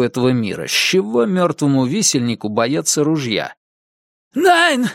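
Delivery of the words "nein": very high-pitched cry of protest; high, frantic German shout; cried out. Acted. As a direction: cry out in alarm, pitch leaping up. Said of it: "крикнул он".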